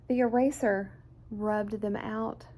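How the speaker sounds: ordinary delivery, nothing marked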